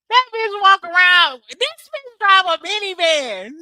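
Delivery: giggling at the minivan image; laughing. Real, not acted. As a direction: laugh it out in disbelief.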